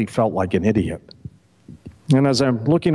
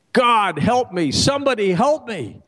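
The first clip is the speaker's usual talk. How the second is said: a mild mock yell, pitched up; mock scream for help; 8 semitones higher than usual. Man clinging to a ledge, calling for help in a mild mock yell. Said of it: "And I'm screaming".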